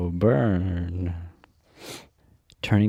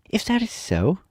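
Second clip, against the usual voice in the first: joyful; 11 semitones higher than usual. Acted. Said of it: "said the child joyfully".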